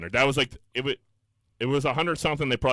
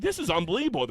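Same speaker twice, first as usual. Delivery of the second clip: retold outrage; 7 semitones higher than usual. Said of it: "Screaming at the top of my lungs".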